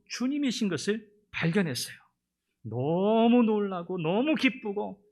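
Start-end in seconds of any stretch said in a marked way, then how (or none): 2.6-5.0 slow, drawn-out and higher with wonder; overwhelmed joy. Acted. Preacher describes Mary's joy, slow and awed. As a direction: savor the astonishment. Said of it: "preacher emotes".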